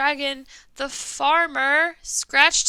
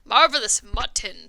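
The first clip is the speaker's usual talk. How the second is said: lower, louder dragon savouring a meal; 5 semitones lower, 5 dB louder than usual. Acted. Dragon relishing its meal, low and loud. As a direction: growl it out like a greedy dragon. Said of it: "said the dragon".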